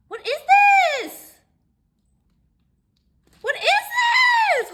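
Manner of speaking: very high, loud, drawn-out squeal; squealed amazement. Real, not acted. Opening a baby gift, squealed amazement. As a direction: shriek it in delighted disbelief. Discovering a surprise package.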